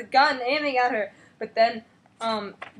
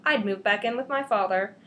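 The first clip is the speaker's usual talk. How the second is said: ordinary talk, nothing marked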